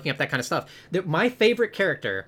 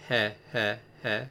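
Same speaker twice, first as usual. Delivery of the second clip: low, soft mock sinister chuckle; mock creepy little laugh; 5 semitones lower, 6 dB quieter than usual. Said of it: "It's so creepy that you just did that".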